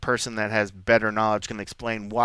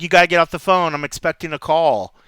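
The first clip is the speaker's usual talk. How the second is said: louder, raised insistent voice; acted impatience, pushed up; mock stern demand; 5 semitones higher, 7 dB louder than usual. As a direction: play a parent who needs the line now. Acted family member demanding the phone.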